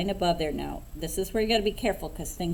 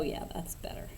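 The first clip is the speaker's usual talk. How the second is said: whisper; 7 dB quieter than usual. Real, not acted. Pleased with the second coat.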